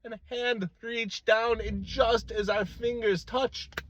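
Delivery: high, slow, drawn-out wonder; hushed down from the shout but still soaring; awed dream climax. Acted. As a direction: stretch out the suspense, pitched high.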